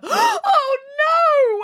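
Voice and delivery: high shrill wail of alarm. Acted. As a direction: wail it in panic. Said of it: "wailed Boris".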